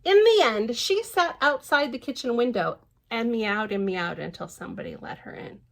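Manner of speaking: lively storybook reading voice; picture-book narration; reading to children. Acted. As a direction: read it out brightly to little ones. Reads the book aloud.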